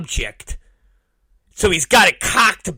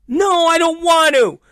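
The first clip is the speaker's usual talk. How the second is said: put-on whiny kid; 11 semitones higher than usual. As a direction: plead like a scared kid. Son refusing dad.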